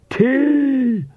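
high, drawn-out vampire shriek; screamed. A narrator's reading. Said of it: "one screamed".

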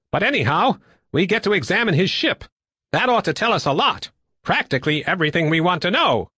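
high-pitched, eager character voice; eager pilot. Acted. Woodbine getting to examine the ship, high and eager. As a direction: lift it high with lively swoops.

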